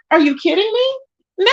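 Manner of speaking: high, slow, drawn-out disbelief; slow incredulous. Real, not acted. Ranting to viewers about karma, slow incredulous.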